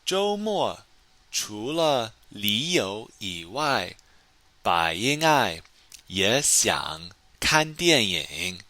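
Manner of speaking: slow, careful model reading; slow model sentence. Shown as a demonstration. Teacher reading the answer to students, slow and careful. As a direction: spell each word out for learners. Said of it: "中文怎么说？".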